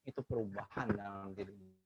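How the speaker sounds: ordinary delivery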